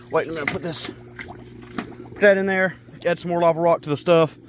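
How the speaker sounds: winded, short of breath. Real, not acted. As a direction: talk while catching your breath. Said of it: "out of breath right now".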